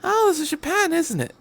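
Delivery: high, amused knowing quip; grinning. Acted. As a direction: say it with an audible grin. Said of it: "hear the doctor grin".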